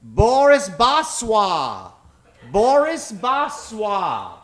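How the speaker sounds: normal talking voice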